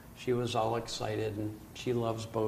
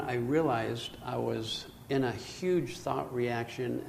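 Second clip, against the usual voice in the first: normal talking voice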